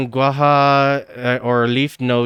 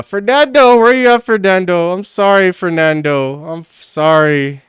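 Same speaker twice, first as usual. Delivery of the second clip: calling out; 6 semitones higher, 5 dB louder than usual. Real, not acted. Calling out to a fan.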